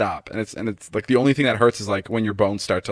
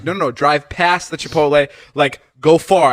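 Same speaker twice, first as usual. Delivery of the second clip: louder, higher, mock urgent; retold urgent insisting; 5 semitones higher, 6 dB louder, slower than usual. Runner telling his ride to drive past, urgent insisting. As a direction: re-enact pleading down the phone.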